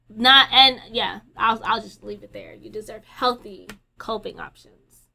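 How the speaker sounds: ordinary talk, nothing marked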